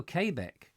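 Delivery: "Quebec" said as "kay" plus "beck"; Quebec as KAY-bek. Shown as a demonstration. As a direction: echo "Quebec" with KAY at the start. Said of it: "it's pronounced Quebec".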